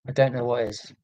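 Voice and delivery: usual speaking voice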